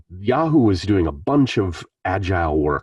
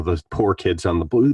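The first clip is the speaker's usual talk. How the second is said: ordinary talk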